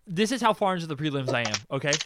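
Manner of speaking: usual speaking voice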